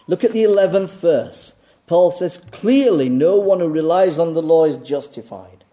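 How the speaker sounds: loud, high, ringing pulpit delivery; preaching at full force. Acted. Preacher hammering clarity to his flock, loud and high. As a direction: hammer the point home to the congregation.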